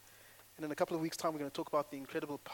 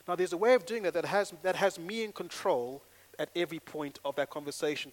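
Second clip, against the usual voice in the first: normal talking voice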